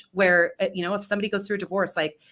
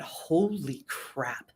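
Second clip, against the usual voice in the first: slow, softened stunned realization; hushed awe; 2 semitones lower, much slower than usual. Retold or imitated. Stunned reaction to the confession.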